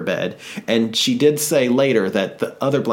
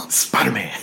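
whispering; slower than usual. Pretend. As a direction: whisper it back conspiratorially. Whispering reply.

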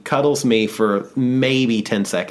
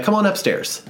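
lightly voiced friend; 10 semitones higher than usual. Friend invites him upstairs.

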